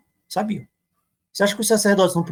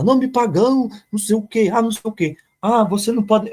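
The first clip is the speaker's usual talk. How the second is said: higher, mocking imitation of a know-it-all critic; 6 semitones higher than usual. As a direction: parody the online zealot, a touch scornful. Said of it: "e vai começar a falar".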